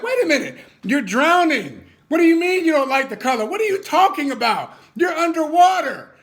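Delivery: high, loud, laughing disbelief; incredulous shout. Retold or imitated. Speaker to a drowning friend refusing a lifeline, loud laughing disbelief. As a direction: yell it like they're crazy to refuse. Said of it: "And you're like".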